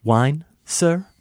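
stiff, formal waiter voice; acted film scene. Hosts acting a movie scene, lightly voiced roles. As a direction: play the waiter offering wine to his guest. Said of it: "I'm gonna start it off".

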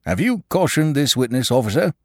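high, curt official question; sharp. Acted. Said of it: "turning sharply to Inspector Sugg".

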